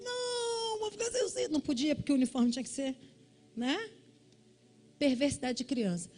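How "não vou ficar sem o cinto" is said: put-on high, soft whiny kid voice; mock child's whine, high and soft; whining child. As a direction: whimper like a scared schoolkid.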